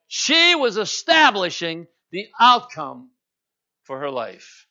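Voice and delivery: loud, slow, ringing sermon delivery; full-force preaching. Acted. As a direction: preach it out to the whole church, drawn out.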